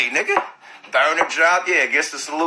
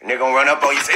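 normal talking voice

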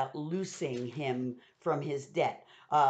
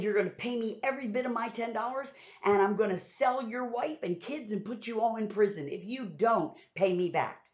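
higher-pitched, hammed-up angry demand; acted violent threat; 4 semitones higher than usual. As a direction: threaten like an angry creditor in a retold parable. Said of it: "got violent, began to choke him".

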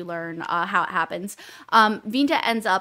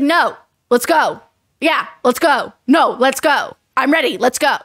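loud, pushy let's go; impatient insistence; 3 semitones higher, 7 dB louder than usual. Retold or imitated. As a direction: brush him off and push on. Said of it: "she's just like".

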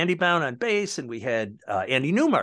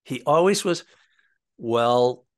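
ordinary talk, nothing marked

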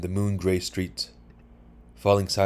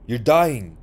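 loud, higher-pitched cry of alarm; raised voice, pitch jumping up; screamed outcry; 5 semitones higher, 7 dB louder than usual. A narrator's reading. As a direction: cry it out in sudden horror. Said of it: "he screamed".

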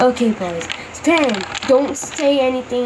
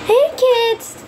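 put-on higher-pitched call to the kids; 7 semitones higher than usual. Parent summoning the kids, calling out. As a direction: call the kids over brightly.

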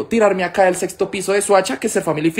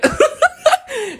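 carcajada aguda. Real, not acted. Laughs at dog story.

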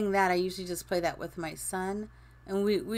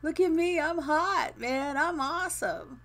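much higher, swaggering self-praise; high mock-boastful gamer voice; cocky brag; 7 semitones higher than usual. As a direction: strut like you're the champ. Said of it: "you're like".

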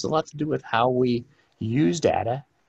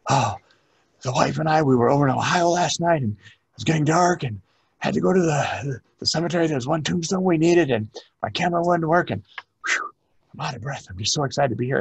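gushing excited patron; 5 semitones higher than usual. Retold or imitated. Patron gushing to the archivist, breathless excited chatter. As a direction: rattle it off like an overexcited visitor. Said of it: "they start telling you about".

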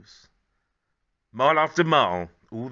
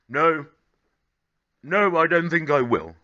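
smiling Grandad; 6 semitones higher, 8 dB louder than usual. Acted. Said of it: "said Grandad, smiling".

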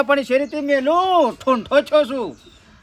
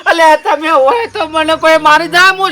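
angry complaint; 4 semitones higher, 6 dB louder than usual. Acted. Angry complaint about beating.

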